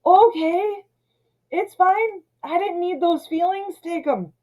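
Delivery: hammed-up sarcastic 'it's fine', high; mock hurt sarcasm. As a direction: act wounded but pretend it's fine. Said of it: "And just like".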